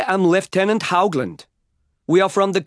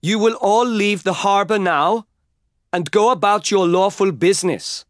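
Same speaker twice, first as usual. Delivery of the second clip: loud public order; 6 semitones higher than usual. A narrator's reading. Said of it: "loudly enough for everyone to hear".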